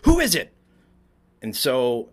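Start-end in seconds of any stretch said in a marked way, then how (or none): 0.0-0.5 high, loud call "Who is it?"; nun shouts at the knock; shouting nun. Retold or imitated. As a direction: call through the bathroom door. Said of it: "she shouts".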